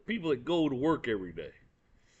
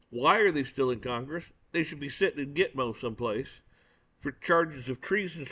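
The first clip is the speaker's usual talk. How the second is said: ordinary talk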